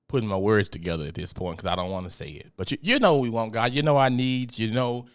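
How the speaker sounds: normal talking voice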